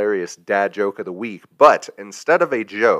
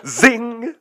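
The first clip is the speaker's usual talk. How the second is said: high, triumphant punchline cry; zing. Real, not acted. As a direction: cap the joke with a cocky zing. Punchline after a dad joke.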